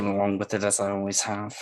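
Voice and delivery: soft, low under-the-breath mutter; muttering to himself. Acted. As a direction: talk quietly to yourself, half swallowed. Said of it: "whispering to himself".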